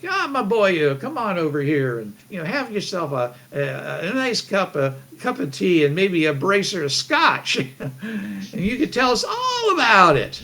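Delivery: lightly done Irish brogue, friendly; a hint of a jolly Irish host; fake Irish accent. Irish host welcoming the traveller, mild fake Irish accent. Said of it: "do my fake Irish accent".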